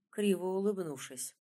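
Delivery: ordinary delivery, nothing marked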